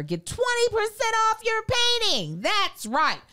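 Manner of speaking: high excited pitchman; hyped ad voice. Acted. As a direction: sell it like a hyped announcer.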